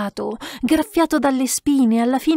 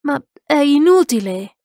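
raised, louder burst of exasperation; exasperated exclamation; 5 semitones higher, 5 dB louder than usual. A narrator's reading. Man groping in the dark to the monk, exasperated. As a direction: cry out, fed up.

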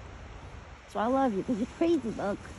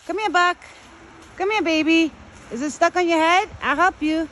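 high, loud coaxing call; calling an animal; 7 semitones higher, 3 dB louder than usual. Real, not acted. Woman calls her buck to come here, calling out. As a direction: call out to coax a big pet over.

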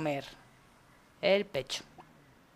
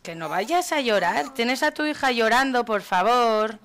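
loud, raised exasperated plea; exasperated scolding; 4 semitones higher, 5 dB louder than usual. Real, not acted. Player scolds the mother over her crying daughter, exasperated. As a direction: scold loudly, begging for some sense.

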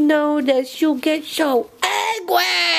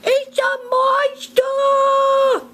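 high, slow, hammed-up cry of fright; mock scared scream; 13 semitones higher, slower than usual. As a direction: imitate a sleeper waking in terror. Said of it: "they sometimes wake up screaming".

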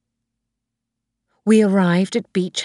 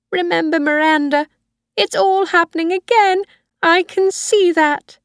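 put-on much higher, frail old mother's voice, fearful; a high old woman pleading with her son; old Mrs Harvey; 12 semitones higher, 5 dB louder than usual.

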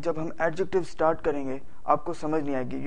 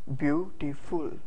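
Beautiful slowly split, "byu... ti... ful"; beautiful drawn out with gaps between syllables; slower than usual. Shown as a demonstration. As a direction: count out three slow beats in beautiful. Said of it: "उनको अलहदा-अलहदा बोलना होता है".

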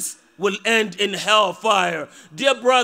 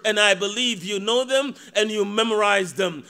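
usual speaking voice

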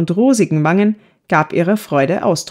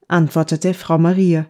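ordinary delivery, nothing marked

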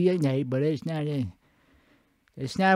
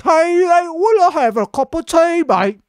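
hammed-up Cockney-style British voice; mock British accent; 10 semitones higher than usual. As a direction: put on a cheery British accent. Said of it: "tea's more like".

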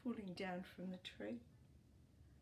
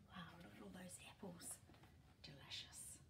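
whisper; 11 dB quieter, faster than usual. Real, not acted. Aside to child about picture.

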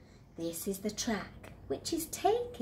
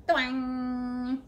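loud twang; 3 semitones higher, 6 dB louder than usual. Acted. Rope slipping sound.

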